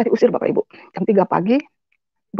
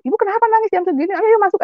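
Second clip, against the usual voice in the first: much higher voice for the kindly guard; beach guard; 11 semitones higher than usual. Retold or imitated. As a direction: call her in, concerned guard.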